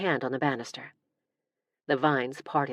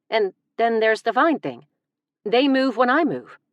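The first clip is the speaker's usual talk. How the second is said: higher and louder, animated; giddy insistence; excited insistence; 7 semitones higher, 7 dB louder than usual. Acted. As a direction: convince them breathlessly.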